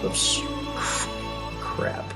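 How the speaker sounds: ordinary talk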